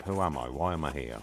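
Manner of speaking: normal talking voice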